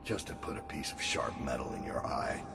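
barely above a breath, still and cold; very hushed. Acted. Assassin bidding the dead Regent farewell, very hushed. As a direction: let the words sink almost to nothing. Same lines, softer take, music under.

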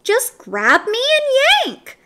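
high, eager little tempter; high-pitched talking pigtail, goading; pigtail's little voice. A narrator's reading. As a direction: urge him on in a tiny voice. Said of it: "said the pigtail".

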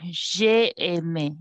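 "j'ai aimé" drawn out slowly; slow, careful French demonstration; slow teaching model. Shown as a demonstration. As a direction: stretch out each part of "j'ai aimé". Teacher models the correct form.

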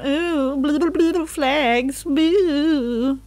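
loud, drawn-out "blue, blue, blue"; playful mock cheer then boo; mock crowd. Real, not acted.

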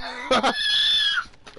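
shrieking laugh. Real, not acted. Loud laugh at screams.

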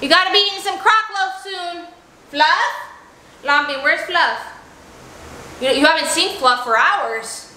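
usual speaking voice

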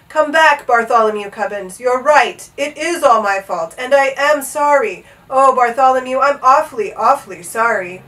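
loud, pleading apology with a touch of crying; tearful king. A narrator's reading. Old king begs Bartholomew to come back, mildly tearful. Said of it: "The old king was crying".

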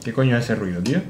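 ordinary delivery

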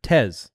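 Tez said with a buzzing Z; voiced Z. Shown as a demonstration. Co-host explaining the Tesla question, Z pronunciation. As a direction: end Tez on a clear Z sound. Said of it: "As though it's a Z".

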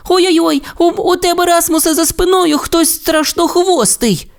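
very high stammering alarm; frightened shout. A narrator's reading. Timmi warns Rasmus of the beast behind him, very high. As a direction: warn him of the monster behind. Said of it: "Тім закричав".

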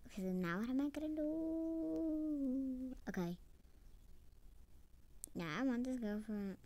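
usual speaking voice

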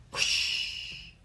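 long, hushed gust; airy, fading whoosh; soft wind whoosh. A narrator's reading. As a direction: breathe out a gentle wind. Narrator imitates distant wind.

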